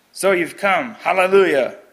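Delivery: hammed-up sneering welcome. A narrator's reading. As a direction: jeer at the captive from across the room. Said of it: "a stranger sneered".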